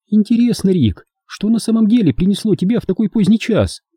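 high, questioning female voice; curious woman character. Acted. Hilda asks Rick why he came so late, high curious woman's voice. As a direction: ask it high, as a woman wondering.

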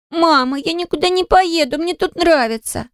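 put-on high, whiny child's protest; crying little girl. A narrator's reading. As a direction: whine like a tearful small child. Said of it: "Кара тут же заплакала и затопала ножками". (плаксивым детским голоском)

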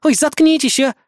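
high, loud exasperated outburst; irritated snort. A narrator's reading. Azumi fed up with both companions, irritated. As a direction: tell them off in girlish annoyance. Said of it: "фыркнула Азуми".

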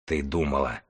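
quiet strained words through clenched teeth; through gritted teeth. A narrator's reading. The scholar grits out his reply to the healer, quiet and strained. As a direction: hiss it low through teeth. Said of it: "процедил книжник сквозь зубы".

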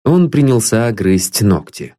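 usual speaking voice

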